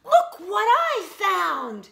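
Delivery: slow, loud, high excited call; shouting. Acted. Jessica shouting home to the frogs, loud and excited. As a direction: proudly call out a find.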